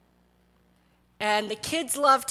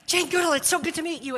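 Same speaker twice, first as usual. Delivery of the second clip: fast, gushing and a bit higher; breathless rush; 4 semitones higher, much faster than usual. Retold or imitated. Tyler meeting Jane Goodall, fast breathless gush. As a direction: blurt it all out in one breath. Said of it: "he was out of breath".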